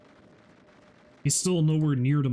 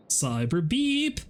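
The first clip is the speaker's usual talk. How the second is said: slow, drawn-out proud naming; slow grand announcement; 4 semitones higher, much slower than usual. Pretend. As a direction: stretch it out like a grand title. Chanting the new name.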